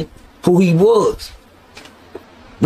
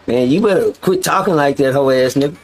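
ordinary talk, nothing marked

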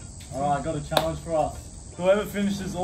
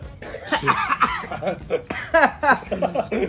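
rising cackle; 5 semitones higher than usual. Real, not acted. Laughing at challenge.

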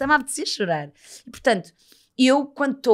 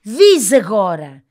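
slow loud urging; 12 dB louder, much slower than usual. Retold or imitated. Urging friend to speak.

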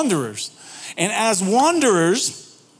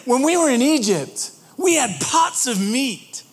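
whiny nostalgia; 6 semitones higher than usual. Acted. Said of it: "They start to go".

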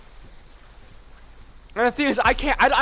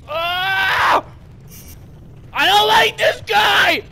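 startled yell; 9 semitones higher, 4 dB louder, slower than usual. Real, not acted. Startled by a monster.

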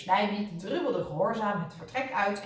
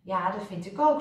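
ordinary delivery, nothing marked

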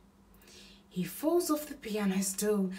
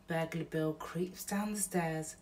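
plain reading voice

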